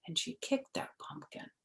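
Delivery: whispered narration; hushed storytelling aside. A narrator's reading. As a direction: whisper the kick to the children.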